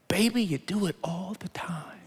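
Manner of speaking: soft teasing whisper; whispered aside. Real, not acted. Preacher whispering a teasing aside to the listeners. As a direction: lean in and whisper it.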